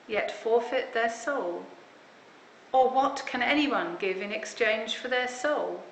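usual speaking voice

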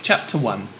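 usual speaking voice

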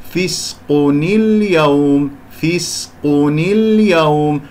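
فسق اليوم slow, joined as fisqu-ni-l-yawm; linked with kasra. Shown as a demonstration. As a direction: glide from فسق into اليوم through an added "ni". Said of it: "هكذا". (وصل فسق اليوم ببطء بكسر نون التنوين)

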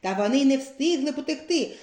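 plain reading voice

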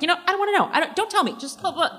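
high-pitched mock refusal with a laugh; playfully imitating a flustered dismissal; imitated comic brush-off. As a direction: back away from it, voice up high. Acting out castmate's improvised hallway retreat.

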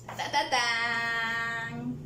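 soft, drawn-out sung "ta, ta, taaan"; drumroll "ta-ta-tan". A narrator's reading. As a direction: hold the last note of a little fanfare, softly. Narrator sings a transition flourish. (ta, ta, tan de suspenso, suave y alargado)

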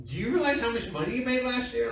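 high-pitched, fast retold disbelief; incredulous. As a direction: imitate a boss who can't believe it. Retold incredulous question from work.

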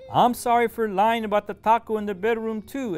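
sorrowful admission. A narrator's reading. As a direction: confess it full of regret. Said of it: "admits Jose sorrowfully".

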